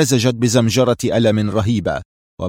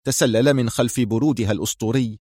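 ordinary delivery, nothing marked

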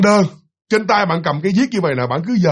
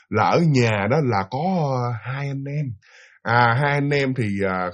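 low, slow, halting retelling; reluctant confession; 5 semitones lower, 4 dB quieter, slower than usual. Retold or imitated. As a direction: imitate the boss quietly opening up. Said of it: "họ mới nói".

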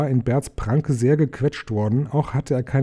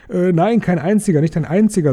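much higher, a bit louder, rushed denial; hasty panicked denial; 6 semitones higher, 4 dB louder than usual. A narrator's reading. Bilbo, dangled by the troll, panics and takes it back. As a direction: backpedal in a panic.

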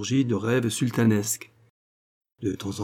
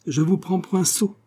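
high, cutting reply; brusque, brittle put-down; curt retort; 8 semitones higher than usual. A narrator's reading. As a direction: snap back with contempt. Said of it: "répliqua le notaire d'un ton cassant".

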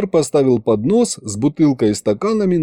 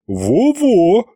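eager agreement; 8 semitones higher than usual. A narrator's reading. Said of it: "поддержала главбух".

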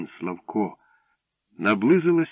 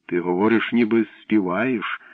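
plain reading voice

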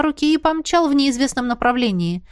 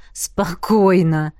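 snorting dismissively; 3 semitones lower, much slower than usual. A narrator's reading. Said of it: "фыркнула Тисара".

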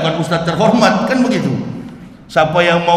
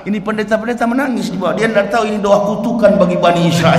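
normal talking voice

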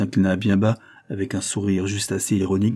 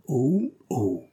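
two more tones; 5 semitones higher than usual. Acted. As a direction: move each "oh" to a different pitch. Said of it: "fit le paillasse sur trois tons différents".

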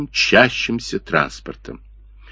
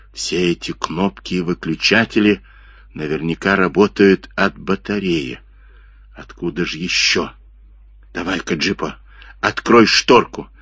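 usual speaking voice

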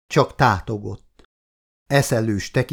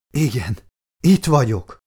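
plain reading voice

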